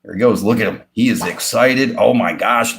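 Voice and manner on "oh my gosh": ordinary delivery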